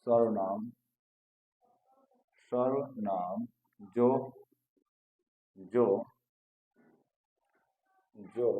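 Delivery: isolated words with long gaps; slow dictation. Real, not acted. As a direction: talk as you write, word by word. Dictates while writing on board.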